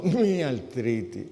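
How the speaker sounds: drawn-out, whiny complaint; slow, put-on woe-is-me moan; mock self-pity. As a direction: imitate a sufferer fishing for pity. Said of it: "ponen una cara de pena así, para que tú le cojas pena".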